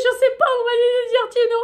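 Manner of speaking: high, bright-eyed shop cashier. Retold or imitated. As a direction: play the chirpy cashier up high. Cashier voiced in retold story. (voix de caissière aiguë)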